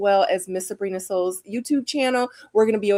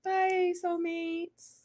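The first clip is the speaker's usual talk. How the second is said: high sing-song goodbye; 10 semitones higher, 9 dB quieter, much slower than usual. Real, not acted.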